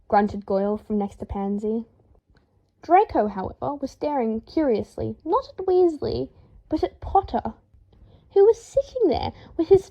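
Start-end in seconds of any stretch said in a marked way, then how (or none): none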